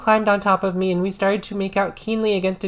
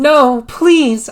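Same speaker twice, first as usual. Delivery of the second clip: begged; 5 semitones higher, 8 dB louder than usual. A narrator's reading. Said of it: "I begged".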